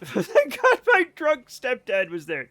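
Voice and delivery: slow, high, laughing through the words; words broken up by laughter. Real, not acted. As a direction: read it out while cracking up. Bursts out laughing reading a message.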